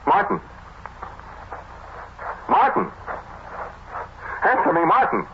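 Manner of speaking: calling out desperately into the dark; panicked calling. Acted. Austin calling for vanished Martin in the tomb, panicking.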